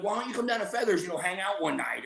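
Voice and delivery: a hint of a coaxing buddy; mock inviting friend. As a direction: lure him in lightly.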